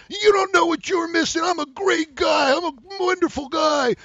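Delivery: high, shouted mock bawling; hammed-up wailing protest at full volume; tantrum. Rejected guy yelling at the girl, bawling like a baby. Acts out needy guy's tantrum.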